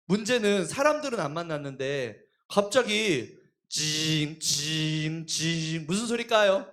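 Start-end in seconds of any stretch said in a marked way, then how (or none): 3.7-5.8 low mock buzzing "징"; phone buzz imitated.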